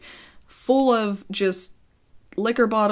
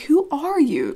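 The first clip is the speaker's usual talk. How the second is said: higher-pitched retold challenge; mock challenge; 8 semitones higher, 4 dB louder, slower than usual. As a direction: toss it at her as a put-down. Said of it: "you guys know that thing".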